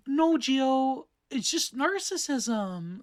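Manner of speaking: slow, drawn-out put-on voice of his friend; teasing impression of his friend, stretched out; friend's character voice. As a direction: mimic her voice from the videos, slowly. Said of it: "I'm trying to do her, her character voice".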